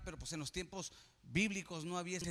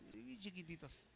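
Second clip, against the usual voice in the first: tiny squinted voice; 13 dB quieter than usual. Acted. Mocking tiny squinting eyes.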